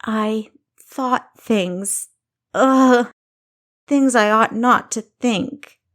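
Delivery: slow then a loud groan of disgust; self-disgusted outburst. Acted. Kessa confessing to Thomas, a loud disgusted groan. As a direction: she can't bear her own thoughts.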